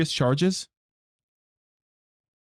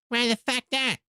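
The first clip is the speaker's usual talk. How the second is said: much higher, squeaky cartoon kid; 9 semitones higher than usual. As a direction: snap it back like a bratty cartoon kid. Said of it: "Fucking South Park".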